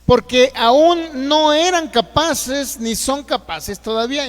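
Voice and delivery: very slow, loud, stretching aún; forceful scripture reading. Real, not acted. Preacher stressing the verse to the congregation, very slow and loud. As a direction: drive the verse home word by word.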